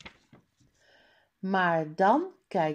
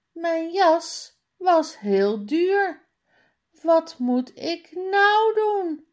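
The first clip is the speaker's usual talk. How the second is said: put-on crying boy; 10 semitones higher, 6 dB louder than usual. A narrator's reading. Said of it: "Jan huilt".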